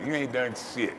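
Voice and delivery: ordinary delivery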